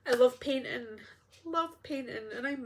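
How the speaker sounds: breathy whispered words, choked up; emotional whisper. Real, not acted. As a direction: whisper it as if moved close to tears. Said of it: "I'm getting emotional".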